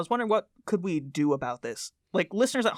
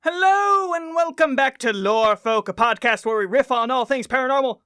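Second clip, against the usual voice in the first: show host introduction; 5 semitones higher, 5 dB louder than usual. Acted. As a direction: hype up the co-host's name like a ring announcer. Host's show opening.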